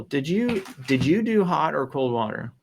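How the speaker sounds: ordinary talk, nothing marked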